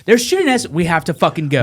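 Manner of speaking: high, urgent insistence; urgent retold plea. Marine urging reporter out of gunfire, urgent. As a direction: urge them to move, pitched right up.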